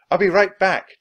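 loud, high call over a distance. A narrator's reading. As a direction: call back to a friend far off. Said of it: "Jack called".

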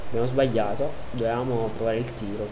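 ordinary talk, nothing marked

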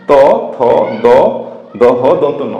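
ত থ দ দহ ন each said alone; dental letters demo. Shown as a demonstration. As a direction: separate beats for ত, থ, দ, দহ. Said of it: "যেমন".

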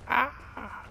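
short high-pitched "ah" shriek; play-scream, high and brief; mock scream. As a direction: let out a quick, pretend yelp of fright. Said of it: "I'm screaming".

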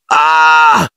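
sudden frightened shout; startled cry. A narrator's reading. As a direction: cry out as the branch hits. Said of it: "gridò Ron".

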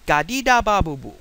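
put-on quick, emphatic toddler gibberish, falling at the end; babbling with conviction. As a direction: babble it firmly as if it were a real sentence. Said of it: "They say it with great conviction".

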